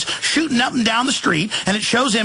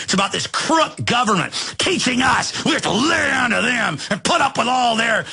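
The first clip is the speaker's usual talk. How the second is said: building furious shouting rant; real anger boiling over into a yell; angry shouting; 2 semitones higher than usual. Real, not acted. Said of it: "man, I'm getting angry".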